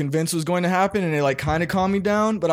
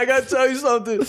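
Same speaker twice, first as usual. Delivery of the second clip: nervous confession; 9 semitones higher, faster than usual. Retold or imitated. Confessing to dad while tripping.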